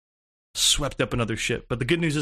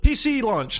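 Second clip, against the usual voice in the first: high, louder mock launch announcement; finto annuncio entusiasta; 10 semitones higher, 4 dB louder than usual. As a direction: crow it like big breaking news. Imagined announcement right after buying.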